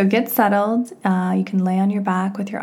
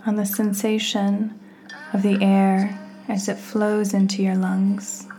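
guided meditation; 5 dB quieter than usual. Real, not acted. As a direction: guide the listener gently inward, phrase by unhurried phrase.

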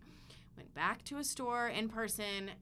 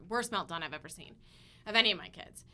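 ordinary talk

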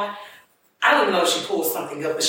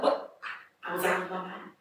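ordinary talk

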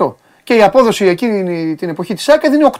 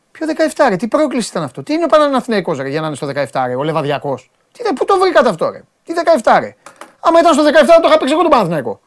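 high-pitched, incredulous protest; scoffing, worked-up disbelief; indignant disbelief; 4 semitones higher than usual. Real, not acted. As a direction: argue as if the claim is absurd, voice jumping up. Disputes the odds a friend found.

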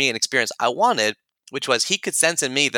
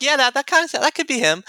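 much higher, amused agreement; a mock-knowing nod in the voice; knowing friends; 3 dB louder than usual. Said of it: "they're like".

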